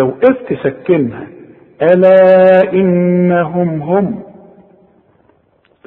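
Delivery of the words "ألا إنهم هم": very slow chanted ألا إنهم هم, louder; Quran recitation. Shown as a demonstration. Teacher reciting the verse in tajweed style. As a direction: chant ألا إنهم هم in long held tones. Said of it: "الميم مضمومة عند الوصل".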